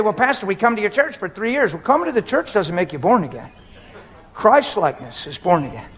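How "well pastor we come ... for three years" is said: high-pitched mock protest; mildly indignant. Preacher mimicking couple in counseling, mildly mad and higher. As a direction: lightly act out an offended churchgoer. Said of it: "then they get mad and say".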